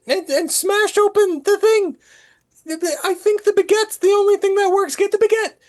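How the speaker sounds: very high, frantic puppet voice; squeaky character voice. Acted. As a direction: squeak orders in a hurry. Player voices Pinocchio to rat.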